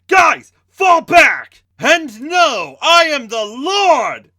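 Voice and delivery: very high, very loud, slow ecstatic yell; wild joyful shout. Acted. Alex calling the group to retreat, wild joyful shout. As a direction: bellow it out in wild joy. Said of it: "Alex shouted with a look of wild joy".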